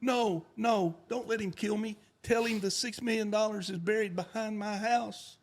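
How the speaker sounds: high mock begging; hammed-up terrified plea; pleading accountant. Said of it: "He goes".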